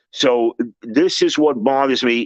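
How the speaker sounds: ordinary talk, nothing marked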